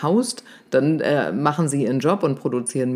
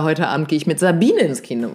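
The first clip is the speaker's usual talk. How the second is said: "Sabine" punched louder, falling afterwards; stress on Sabine; 4 dB louder than usual. Shown as a demonstration. Coach contrasting Sabine with yesterday's companion Katrin, stress on "Sabine". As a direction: land hard on the name "Sabine". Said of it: "weil gestern war ich mit Katrin".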